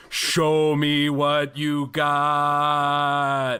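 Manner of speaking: loud, long-drawn mock bellow; hammed-up roar with drawn-out vowels; screamed command. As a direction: bellow it out, stretching every word. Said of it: "scream at the top of their lungs".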